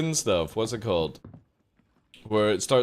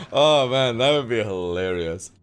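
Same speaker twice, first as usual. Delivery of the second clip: ordinary talk, nothing marked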